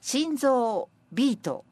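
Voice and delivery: measured verse reading, phrases set apart; senryu recitation. Acted. Presenter reading senryu about a heart in love, measured recitation. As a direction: recite with a pause after each phrase.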